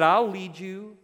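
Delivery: loud, ringing pulpit voice; fervent preacher pressing the point home; preaching at full force. Acted. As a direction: proclaim it to the whole church. Sermon rises again.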